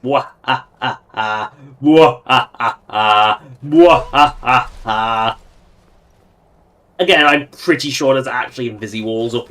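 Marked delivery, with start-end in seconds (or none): 0.0-5.4 mad scientist. Pretend. Evil laugh after bypassing mountain path.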